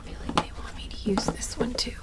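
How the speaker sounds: hushed aside. Real, not acted. Sensing her guides, she whispers which deck to use. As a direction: whisper it half to yourself. Quietly sensing spirit guidance.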